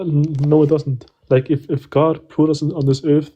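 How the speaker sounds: usual speaking voice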